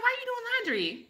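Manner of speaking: high, soft squeak of disbelief; retold baffled question. Teacher questioning her dazed student again, mock baffled. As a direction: pitch it way up but keep it small.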